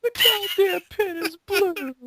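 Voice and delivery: high, slow, drawn-out exasperated cry; fed-up, high, slow repeat; exasperated outburst. Real, not acted. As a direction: stretch every word out in disbelief. Exasperated repeat of pin line.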